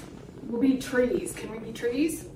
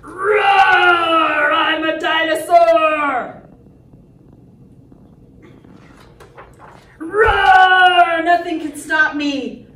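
loud, raised, drawn-out boastful roar; roaring dinosaur; 3 semitones higher, 8 dB louder, slower than usual. Acted. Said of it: "gonna be lots of roaring".

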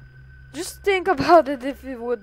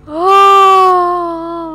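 loud groan; 6 semitones higher, 16 dB louder than usual. Real, not acted. Death in the game.

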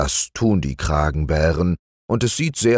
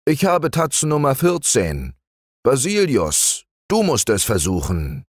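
raised, fussy bear voice; Hieronymus the bear; 9 semitones higher than usual. Acted. Hieronymus stuck at the knothole, fussing to Basilius. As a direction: complain the hole is too narrow.

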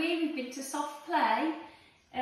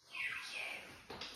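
soft voiceless whisper; 15 dB quieter than usual. A narrator's reading. Lonely chick whispering to nobody, hushed. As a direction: breathe it out, lost and small. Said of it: "he whispered".

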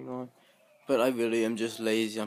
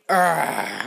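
loud exasperated "arrgh"; frustrated growl; 10 dB louder than usual. Real, not acted. Vlogger failing to film the robot, frustrated growl.

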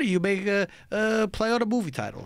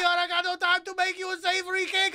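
hammed-up baker; 8 semitones higher than usual. Playing the cake boss.

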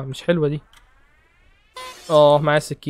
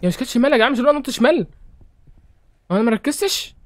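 surprised disbelief; 8 semitones higher than usual. Real, not acted. Confused after losing the round.

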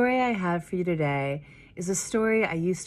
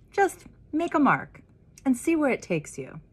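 encouraging teacher; 9 semitones higher, 4 dB louder than usual. Acted.

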